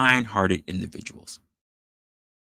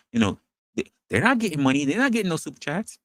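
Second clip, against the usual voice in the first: ordinary delivery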